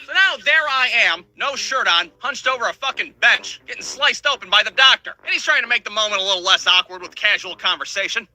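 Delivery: normal talking voice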